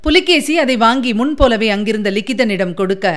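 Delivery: plain reading voice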